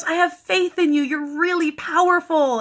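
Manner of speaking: high, loud imitated coaxing; coaxing flattery. Dying man flatters his healer to come along, coaxing. Billy flattering her to persuade.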